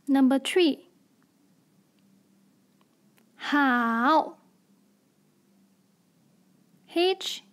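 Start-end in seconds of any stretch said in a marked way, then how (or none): 3.4-4.4 好 in a low dipping third tone; drawn-out 好 with a dip in the middle. Shown as a demonstration. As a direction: let 好 sink low, then rise. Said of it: "Third tone".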